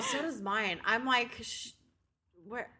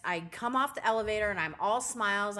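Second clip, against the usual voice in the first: ordinary delivery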